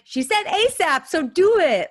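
high, slow, loud mock command; bossy insistence. Friend pushing the other to send the link, bossy shout. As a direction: spell it out to a stubborn sibling. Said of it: "siblings who fight all the time".

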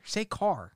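"car" with a clear final R; R pronounced. Shown as a demonstration. Host testing Bostonian friend, R pronounced. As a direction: sound the R at the end of "car". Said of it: "Can you say an R?".

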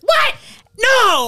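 very high, shrieked exclamations; mock screaming. Host mimicking co-host's reel reactions, high mock screams. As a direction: imitate her screaming reactions. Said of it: "I don't talk other than screaming in these".

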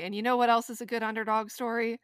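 normal talking voice

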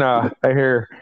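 ordinary talk

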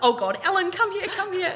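high, loud, urgent mock summons; imitating mum calling out. Mum calling dad over to the phone, mock urgency. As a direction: call someone over urgently, rising high.

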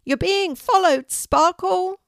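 high, loud shout across the street; a neighbour cat yelling in excitement. Acted. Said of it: "can't help herself and shouts out".

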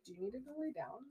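very soft, caring question; soft and concerned. Retold or imitated. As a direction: ask it gently, like a worried friend. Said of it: "it's like".

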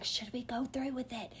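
hushed phone whisper. Retold or imitated. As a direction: ask it under your breath so no one hears. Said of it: "like, whispering on the phone".

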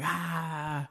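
high, soft drawn-out "ah"; imitated drowning cry. Storyteller imitating his drowning little brother, mock cry. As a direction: mimic a panicked cry at reduced volume.

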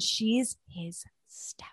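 slow, hushed, voiceless aside; whisper. Real, not acted. Cohost revealing the stepmom twist, whispering. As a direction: let the scandal drop in a whisper. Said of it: "whispered reveal".

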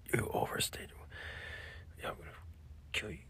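hushed whisper. Pretend. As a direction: keep it whispered. Continues whispering.

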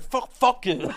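high-pitched mock curse; mum swearing. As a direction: blurt it out like a flustered mum. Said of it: "she went".

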